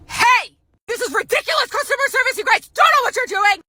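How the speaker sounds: high, shrieked outrage; yelling customer. Acted. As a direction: shriek a complaint down the phone. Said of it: "if you don't stop yelling".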